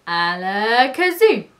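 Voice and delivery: slow spell swelling loudly upward; magician's incantation. A narrator's reading. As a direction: wind up the spell to a big finish. Keith tries another spell.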